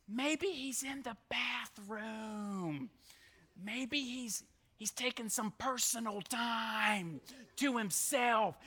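soft, drawn-out mock discretion; confidential taunt. Elijah suggesting Baal is in the bathroom, hushed teasing.

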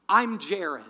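acted, loud and firm introduction. Mugging victim offers his hand to the mugger, loud introduction. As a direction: announce the name loudly, as in a retold exchange.

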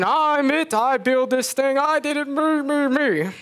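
high, boastful me me me; bragging king. Retold or imitated. Cow mimicking the bragging king, high boastful voice. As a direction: crow like a vain king. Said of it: "He was saying how great he was".

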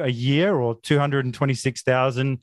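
ordinary delivery

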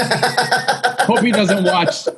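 high, very slow laughing words. Real, not acted. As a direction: laugh through the line. Laughing at own joke.

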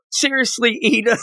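put-on high, incredulous girlish voice; teasing classmate scolding Ida; Momo impression. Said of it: "And then there's Momo".

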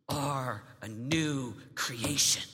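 slow, deliberate, low; slow declaration. Acted. Preacher telling teens who they are, slowly. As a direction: weigh every word. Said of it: "emphatic slow declaration".

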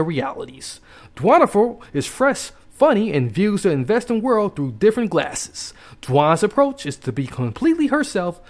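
animated, lilting ad read; sponsor ad read. Acted. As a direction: sell it with big swings in pitch.

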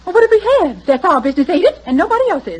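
indignant retort. Acted. Old woman snapping at the doctor, indignant. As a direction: bristle and defend your privacy. Matty bristles at question.

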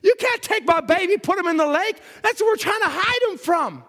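high, frantic pleading near tears; mother begging not to lose her baby. Acted. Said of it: "she starts to cry and say".

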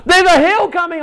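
high, loud mock-panicked warning; acted alarm. Boy on bicycle sees the slope coming, alarmed call. Retold child warns dad of hill.

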